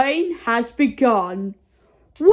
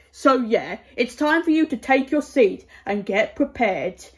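usual speaking voice

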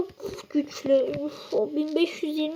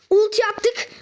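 normal talking voice

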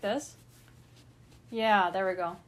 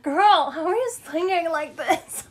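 laughing; 5 semitones higher, 7 dB louder than usual. Retold or imitated. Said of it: "and I'm like".

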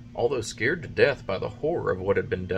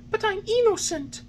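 put-on high wooden-boy voice, anxious; high puppet boy; 4 dB louder than usual. A narrator's reading. Pinocchio answering the carabineers, anxious and high.

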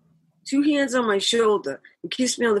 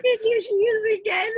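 laughing; 14 semitones higher than usual. Real, not acted. Laughing, voicing Frank.

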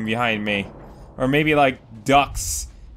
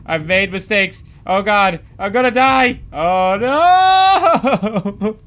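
rising higher and louder into a long wail; mounting mock panic; 6 semitones higher, 5 dB louder, slower than usual. Player about to die in game, mounting panic. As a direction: panic theatrically, building to a howl.

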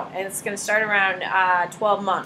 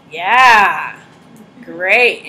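mock enthusiasm; 4 semitones lower, 7 dB louder than usual. Sarcastic response to toddler.

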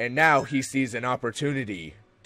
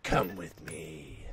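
low, slow, soft Sith master; Sith lord voice; 7 semitones lower, 7 dB quieter, slower than usual. Acted. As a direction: intone it darkly, slowly.

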